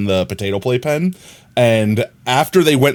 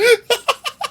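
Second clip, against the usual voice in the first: high squeaky giggle; squeaky laugh after the excuse. Real, not acted. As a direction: let out a high guilty giggle. Laughing at her defence.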